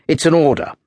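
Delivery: "it's an order" said firm and final; officer giving a stern command. A narrator's reading. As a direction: lay down the law on "order". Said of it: "the third officer's tone".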